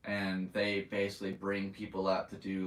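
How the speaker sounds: usual speaking voice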